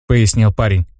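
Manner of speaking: usual speaking voice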